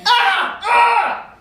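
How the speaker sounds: two high, loud sustained screams. Acted. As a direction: shriek twice, high and full. Fisher interrupted by distant screams.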